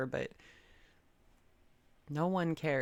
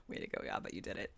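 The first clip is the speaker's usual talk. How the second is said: laughing; 8 semitones lower, 7 dB quieter, faster than usual. Real, not acted. Laughing aside about Yaba.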